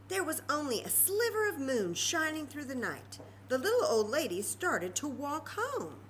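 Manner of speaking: lively storytime read; storybook narration. A narrator's reading. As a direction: read it out to the children. Reading story to children.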